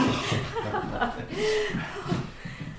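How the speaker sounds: a high, delighted burst of laughter; bright relieved laugh of a landlady; happy laugh. Acted. Relieved about the new lodger.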